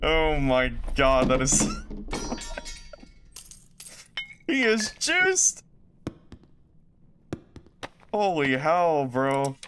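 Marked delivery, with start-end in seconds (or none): 1.7-2.9 high breathless squealing laugh; shrieking jackpot winner. Real, not acted. Laughing at juiced enemy gear. 4.5-5.6 slow, very high amazed exclaim; shrieking jackpot winner. Real, not acted. Amazed by enemy's gear.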